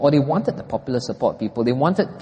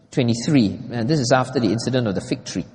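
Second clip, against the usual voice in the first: ordinary talk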